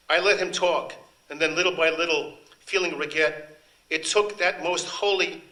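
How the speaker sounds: lightly voiced pleading woman. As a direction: tell him everything, half begging.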